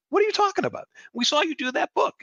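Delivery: high, exasperated mock disbelief; baffled retort. Retelling his reply to liberal friends, high and baffled. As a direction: play up the bafflement, voice shooting up.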